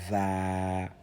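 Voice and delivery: "za" held long and level; lengthened vowel. Shown as a demonstration. Teacher showing alif lengthening, "za" stretched out. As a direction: draw out the vowel of "za". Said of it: "sert d'outil d'allongement".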